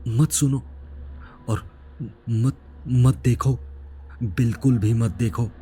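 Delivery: halting, mildly fearful plea; trembling warning. A narrator's reading. Wounded, tearful youth warning the narrator, trembling. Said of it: "और वो कांपते हुए बोला".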